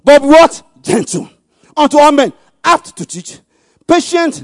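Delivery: high, very loud preaching voice; forceful scripture reading. Real, not acted. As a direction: proclaim the verse at full force. Preacher reads scripture at full force.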